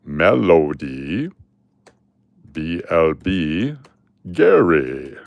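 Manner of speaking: drawn-out drawl, low then swooping sharply up; hammed-up Southern roll call; Uncle Cliff. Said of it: "do my best Uncle Cliff voice".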